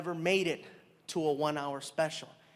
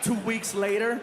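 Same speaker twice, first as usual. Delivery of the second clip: ordinary talk, nothing marked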